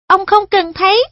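put-on a polite boy guiding an elder; earnest boy voice. Perrin answers.

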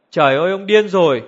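narrated angry exclamation; angry shout. A narrator's reading. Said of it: "Vera hét lên giận dữ".

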